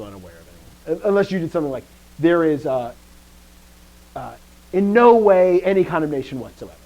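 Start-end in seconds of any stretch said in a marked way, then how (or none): 2.2-6.8 high, loud stress on "no way"; forceful, raised-voice emphasis on NO WAY; emphatic negation. Shown as a demonstration. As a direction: hammer "no way" and carry it through. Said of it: "you could do it like that".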